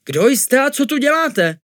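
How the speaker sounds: very high, loud innkeeper; indignant challenge. A narrator's reading. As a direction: confront intruders in a shrieky high voice. Innkeeper confronts the intruders. (rozhořčeně, vysokým hlasem)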